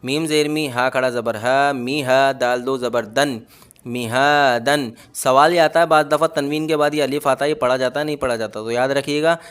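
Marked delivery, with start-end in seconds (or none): 3.9-4.9 slow मिहादन with only हा drawn long; teacher's slow joined reading; stretched हा. Shown as a demonstration. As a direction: say मिहादन slowly, lengthen हा, clip the rest. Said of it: "सिर्फ हा खींचेगा इसके अंदर".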